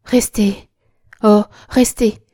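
loud, urgent plea; pleading. A narrator's reading. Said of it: "elle ajouta suppliante". (suppliante, avec force)

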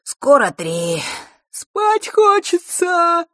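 talking through a big, loud yawn; very slow, high, stretched-out sleepy whine. A narrator's reading. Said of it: "и зевнул". (сонно, зевая во весь рот)

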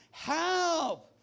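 high, drawn-out cry for help. Acted. Climber lost in fog calls out, mock cry for help. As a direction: call out in mock panic, high and long. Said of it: "you cry out for help".

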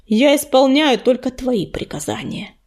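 ordinary delivery, nothing marked

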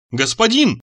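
high-pitched cry to the master; urgent calling out. A narrator's reading. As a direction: call out to wake him. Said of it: "кричал у меня над ухом Мурзик".

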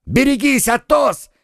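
high, urgent cry of alarm; shouted warning. A narrator's reading. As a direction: yell the warning across to him. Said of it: "крикнул Д'Артаньян".

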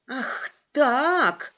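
spiteful hiss. Acted. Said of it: "прошипела она".

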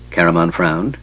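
usual speaking voice

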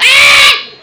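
high, loud drawn-out yell; shout. Acted. Blind man unsure where Jesus is, shouting. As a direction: yell out in every direction. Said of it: "se gritaba para".

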